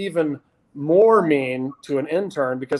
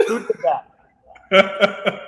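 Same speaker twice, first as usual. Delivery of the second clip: laughing while quoting; 4 semitones higher, much slower than usual. Retold or imitated. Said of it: "Andy'd be like".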